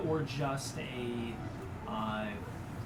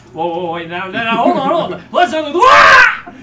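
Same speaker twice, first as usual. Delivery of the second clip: building from quick pleading to a high, loud shriek; a terrified little creature thrown skyward; panicking kobold; 8 semitones higher, 16 dB louder than usual. Acted. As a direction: beg to wait, then yelp as you fly. Kobold being thrown into air.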